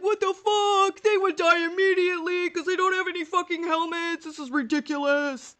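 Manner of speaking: high, loud mock outburst; mock outrage. Lore nerd ranting at helmetless TV soldiers, mock outrage. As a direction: rant like an indignant armor nerd. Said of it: "I'd be like".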